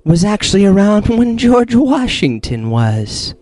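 slow, portentous possessed voice; hammy villain boast with long pauses; the Creator, Kalo impression. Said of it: "with the Kalo impression".

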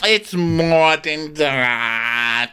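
very slow, exaggerated wail; extremely drawn-out mock retort. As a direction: stretch every vowel as far as it goes.

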